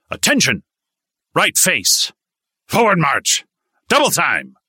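high, loud barked commands; drill commands. A narrator's reading. Drill instructor marching the recruits, loud barked commands. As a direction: snap out each order on the parade ground.

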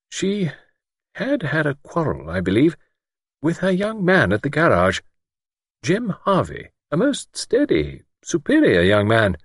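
high-pitched lady, slow and hesitant. A narrator's reading. Miss Wainfleet reluctantly naming the girl's young man, slowly. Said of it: "Miss Wainfleet said slowly".

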